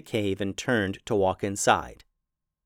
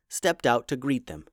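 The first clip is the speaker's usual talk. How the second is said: usual speaking voice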